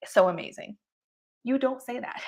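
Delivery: normal talking voice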